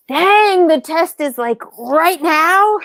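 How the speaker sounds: high, loud, drawn-out disbelief; stunned. Retold or imitated. Husband realizing the test is happening right away, high stunned disbelief. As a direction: stretch out right now in disbelief. Husband reacting to tight timeline.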